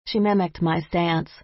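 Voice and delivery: ordinary delivery, nothing marked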